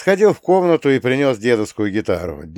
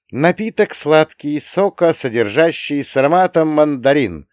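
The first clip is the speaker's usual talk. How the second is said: higher, louder youthful recital; boy correcting grandpa; 6 semitones higher, 4 dB louder than usual. A narrator's reading. Boy improving on grandpa's name for the drink, high pert correction. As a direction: recite it like a product label, pitched up. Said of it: "внёс я улучшение".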